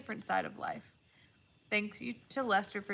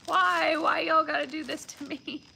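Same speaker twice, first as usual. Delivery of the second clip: higher and louder, overcome by emotion; tearful protest; 6 semitones higher, 6 dB louder than usual. Real, not acted. Thanking viewers for the gifts, voice full of tears. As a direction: plead through tears, touched. Opening moving gifts, crying.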